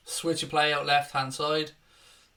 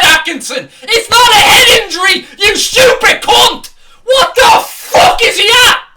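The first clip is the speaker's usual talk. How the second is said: screaming abuse at the referee, much higher and louder; furious shout; 14 semitones higher, 15 dB louder than usual. Real, not acted. Liverpool fan screams at the referee, furious shouting. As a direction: roar it at the ref in real fury.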